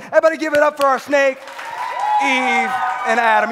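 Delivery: loud, fast hype call to the crowd; hype shout. Real, not acted. Youth pastor shouting praise for the kids' skit. Hyping kids after skit.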